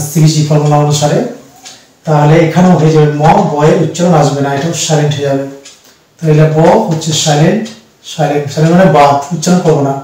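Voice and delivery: usual speaking voice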